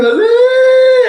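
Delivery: high, loud excited ooh. Retold or imitated. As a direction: squeal it like a happy friend. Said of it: "When the boys ring you".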